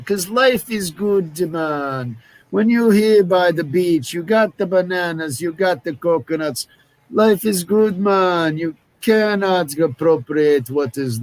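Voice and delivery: high, sing-song put-on Jamaican accent. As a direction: do a hammed-up Jamaican. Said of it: "I was trying to do Jamaican there".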